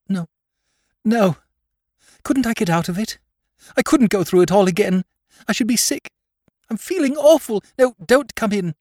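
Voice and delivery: high, frantic pleading voice near hysterics; distraught young woman. Acted. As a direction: push him away in rising panic. Distraught sister refuses help.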